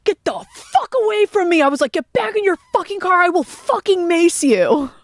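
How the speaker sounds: high-pitched furious yell out the window; yelling threat. Retold or imitated. Telling friends how she yelled at the Range Rover driver, high furious yell. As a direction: scream the threat at him, high and fierce.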